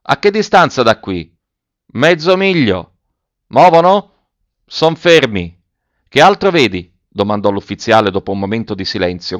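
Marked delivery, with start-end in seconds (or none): 0.0-6.9 high, stretched calls back and forth; short shouted questions and answers over a distance; calling out. A narrator's reading. Officer and boy in the tree, calling out. Shouted exchange, treetop and ground.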